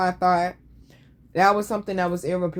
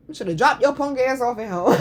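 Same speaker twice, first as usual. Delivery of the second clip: ordinary delivery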